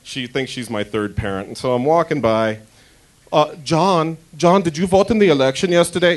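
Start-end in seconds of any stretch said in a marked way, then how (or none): 3.3-6.1 put-on a light German accent for an old nosy neighbour; nosy German neighbour pressing with questions; a light German accent, nosy and prying; German old lady accent. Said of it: "got out of my Liesel voice".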